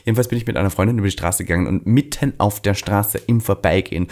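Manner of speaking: ordinary talk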